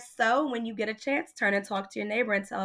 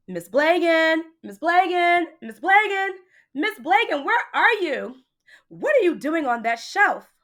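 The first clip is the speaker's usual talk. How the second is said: higher, louder calling out for his daughter; father yelling; 8 semitones higher, 5 dB louder than usual. A narrator's reading. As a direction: call out searching for her, then scold. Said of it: "He yelled".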